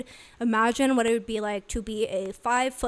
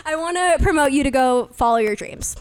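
teary laugh; 3 semitones higher, 5 dB louder than usual. Real, not acted. Emotional advice to aspiring tattooers.

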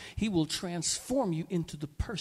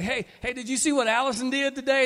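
eager, louder bragging; proud excited father; 5 semitones higher, 7 dB louder than usual. Acted. God telling angels about Allison, proudly excited. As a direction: show off your kid with delight. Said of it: "he's like".

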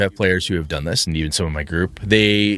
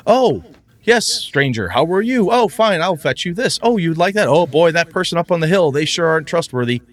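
cheery NPC; 7 semitones higher than usual. Shown as a demonstration. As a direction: chirp it out like an eager innkeeper. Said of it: "plain dialogue where you say".

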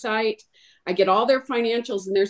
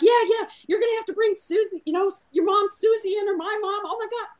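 panicked wife; 11 semitones higher, faster than usual. Retold or imitated. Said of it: "And she's like".

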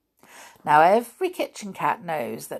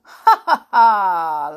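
goblin laughter; 11 dB louder, much slower than usual. A narrator's reading. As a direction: cackle at the cat as a great joke. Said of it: "laughed the hobgoblin".

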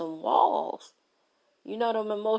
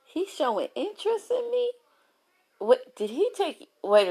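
rising shock, going much higher by the last words; stunned disbelief; 6 semitones higher, 5 dB louder than usual. Retold or imitated. Woman doubting a man's interest, high disbelieving squeak. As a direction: voice climbing to a high, loud squeak of surprise. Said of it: "And you be like".

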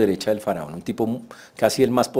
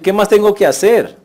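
high and loud, pleading in frustration; a hurt outburst; louder, higher anguished question; exasperated cry; 8 semitones higher, 8 dB louder than usual. Retold or imitated. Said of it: "José podía decir".

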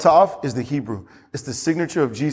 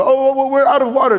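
mock panic; 9 semitones higher, 4 dB louder than usual. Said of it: "You'll never come back and go".

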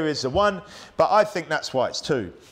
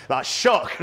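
ordinary talk, nothing marked

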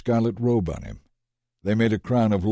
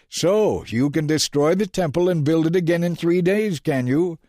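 higher, louder taunt; mocking shout; 7 semitones higher, 3 dB louder than usual. A narrator's reading. Passers-by jeer at Jesus on the cross, loud. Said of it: "shouted abuse".

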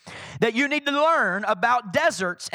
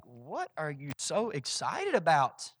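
low, slow, hushed question; quiet bafflement; 6 semitones lower, 9 dB quieter than usual. Acted. Jesus questioning his overexcited Father, quietly baffled. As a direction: ask it softly, drawn out, as if puzzled.

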